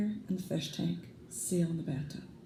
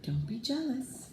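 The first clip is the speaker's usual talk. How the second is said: higher, softer mother's voice, slow; soothing mother; 7 semitones higher, 4 dB quieter, slower than usual. Acted. Mother soothing jealous Kristen, soft higher coaxing. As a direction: coax her out of jealousy.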